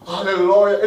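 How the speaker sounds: imitating a hoarse, loud prayer leader; broken voice. Preacher imitating zealous evangelists leading prayer, loud broken voice. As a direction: blast it out with a cracked zealot's voice. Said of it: "ils ont des voix cassées".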